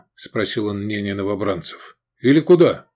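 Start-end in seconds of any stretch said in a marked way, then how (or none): 2.2-2.9 loud, high urgent ask; urgent question. A narrator's reading. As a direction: push it out over gunfire. Asking recruits in battle.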